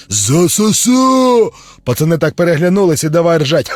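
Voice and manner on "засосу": slow, drawn-out monster howl; bloodsucker cry. Acted. Bloodsucker leaps from the dark, drawn-out monster cry. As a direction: stretch it like a hungry ghoul. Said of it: "як закричить".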